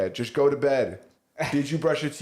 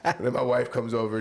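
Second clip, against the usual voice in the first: normal talking voice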